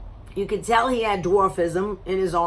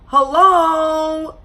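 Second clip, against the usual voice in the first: high, loud incredulous hello; exasperated hello; 7 semitones higher, 7 dB louder than usual. Real, not acted. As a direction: call out in disbelief. Exasperated at date's talking.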